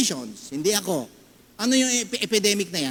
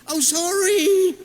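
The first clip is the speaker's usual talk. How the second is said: high, loud, slow drawn-out "sorry"; sarcastic apology; exaggerated sorry; 12 semitones higher, 6 dB louder, much slower than usual. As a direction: stretch the "sorry" mockingly. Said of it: "O, ganun, di ba?".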